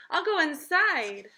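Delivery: high, loud, slow bird voice; Mister Bird. Acted. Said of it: "said Mister Bird".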